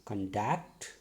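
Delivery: conduct with the stress on DUCT, rising; stress on second syllable. Shown as a demonstration. Teacher showing students word stress, conduct stressed on its second syllable. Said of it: "I am giving stress on a particular syllable or sound, D".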